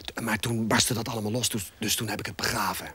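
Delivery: half-whispered, low-pitched confiding; conspiratorial near-whisper; hushed, secretive. Acted. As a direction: keep it down as if someone might overhear. Secretive talk of dangerous book.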